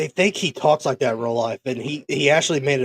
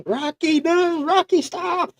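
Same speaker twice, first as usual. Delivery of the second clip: much higher, frantic cry after the dog; mock frantic call; slower than usual. Friend joins the dog scene, squealing the name. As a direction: squeal it like a panicked owner.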